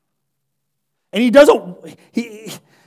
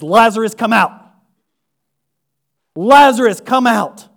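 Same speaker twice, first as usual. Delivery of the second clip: louder, commanding call into the grave; 2 semitones higher, 6 dB louder than usual. Acted. Jesus commands dead Lazarus from the tomb, ringing call. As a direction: call him out with authority.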